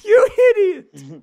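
loud high laughing "You idiot!"; laughing shout. Real, not acted. Gamer laughing and yelling at his clumsy friend. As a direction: bursts out laughing as he yells at the friend. Friend nearly flips tractor.

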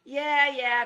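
loud, high dismissive call. A narrator's reading. Fred calls back to the knight, loud and cocky. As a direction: wave him off across the clearing. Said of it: "called Fred".

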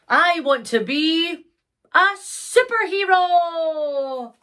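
high, slow, drawn-out proclamation; grand declaration. Acted. Pony giving up magic for superhero life, grand declaration. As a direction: stretch it out like a hero's announcement. Pony announces new ambition.

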